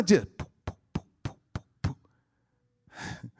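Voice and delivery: soft, fading breathy poop-poop of a heart; heartbeat imitation. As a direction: pump it like a beating heart.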